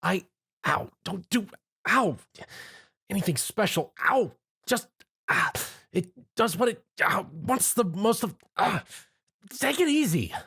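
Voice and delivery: put-on yelping young man being groomed; pained complaining. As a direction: whine through the tugging comb. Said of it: "Tom complained".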